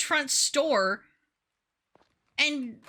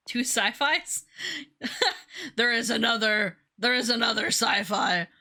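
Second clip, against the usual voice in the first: ordinary talk, nothing marked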